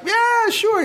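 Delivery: high-pitched eager shout back; cheering crowd. Retold or imitated. Crowd answering the emcee, high and eager. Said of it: "everyone's like".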